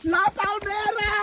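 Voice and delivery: high, long-held holler; slow, high-pitched cry into the distance; drawn-out call. Real, not acted. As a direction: call it out and stretch the last vowel. Calls out to the open air.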